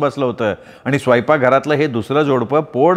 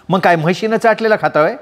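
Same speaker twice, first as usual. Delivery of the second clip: higher, stung comeback; flaring retort; 7 semitones higher than usual. Acted. Radha hits back at her sister-in-law, flaring. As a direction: flare up and throw it back. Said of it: "राधीसुद्धा उसळून बोलली".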